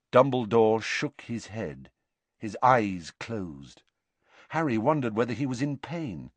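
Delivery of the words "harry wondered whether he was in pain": plain reading voice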